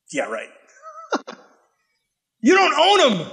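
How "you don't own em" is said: much higher and louder; indignant retort. Real, not acted. Preacher talking back to Satan, high loud outrage. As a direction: fire it straight at the devil.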